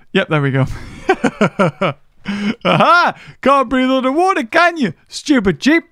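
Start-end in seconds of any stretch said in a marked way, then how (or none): none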